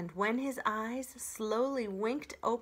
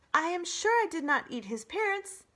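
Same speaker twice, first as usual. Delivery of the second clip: normal robot voice; 10 semitones higher, 6 dB louder than usual. Acted. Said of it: "returning to her normal voice".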